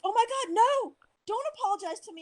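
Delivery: very high retold plea to stop; imitated alarmed protest. Yoga instructor declining an apology, high-pitched imitated protest. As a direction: lift the voice right up, imitating a flustered refusal.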